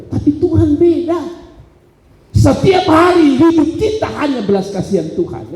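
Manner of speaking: high, driving sermon delivery; forceful preaching. Acted. Preacher urging the congregation to realise why he seeks God, forceful.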